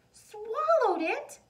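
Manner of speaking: put-on a child crying out in shock at a swallowed thing; alarmed cry. A narrator's reading. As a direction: gasp it out in alarm. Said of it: "Maria cried".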